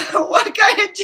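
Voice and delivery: loud high laugh breaking through the words; bursting out laughing. Real, not acted. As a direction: crack up mid-question. Laughing at the retold argument.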